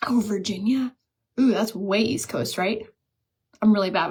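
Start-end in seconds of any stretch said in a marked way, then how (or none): 0.1-1.0 whispered. Real, not acted. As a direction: breathe the name under your breath. Reading palette shade name softly.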